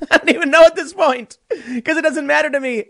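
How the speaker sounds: laughing. Real, not acted. Laughs mid-rant.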